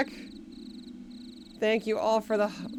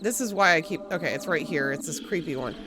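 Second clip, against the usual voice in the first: ordinary talk, nothing marked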